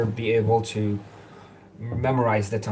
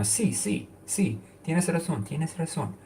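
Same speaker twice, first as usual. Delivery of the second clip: agreeing in Spanish; 7 semitones higher, 5 dB quieter than usual. Retold or imitated. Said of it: "replace it with".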